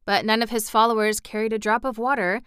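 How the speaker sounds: ordinary delivery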